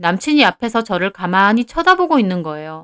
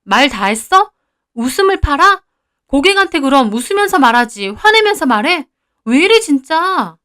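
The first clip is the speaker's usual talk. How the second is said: higher-pitched, offended retorts; indignant arguing; 4 semitones higher, 3 dB louder than usual. Acted. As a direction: fire back at an insulting boyfriend. Acted quarrel with boyfriend. (발끈해서 따지는 말투)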